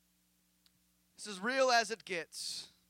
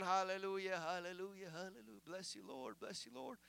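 low mumbled praise, fading away; absent-minded praying; 4 semitones lower, 11 dB quieter than usual. Acted. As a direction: imitate praying on autopilot, softer and lower as it goes. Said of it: "I'm saying stuff, I'm praying, but my mind ain't".

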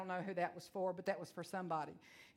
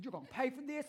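threatening mom; 3 semitones higher, faster than usual. Retold or imitated. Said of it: "I am just ripping him a new one".

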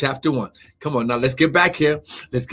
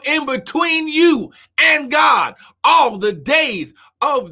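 full-force preaching, words stretched out; scripture preaching; 9 semitones higher, slower than usual. Real, not acted. Preacher promising none can stand against you, scripture preaching. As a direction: declare it with mounting force, holding key words.

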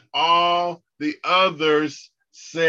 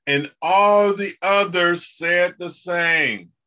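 louder lead line; call and response lead; 5 semitones higher, 5 dB louder than usual. Shown as a demonstration. Teacher leading students in the memory verse, raised voice. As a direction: read it out strongly for the echo. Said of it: "repeat after me".

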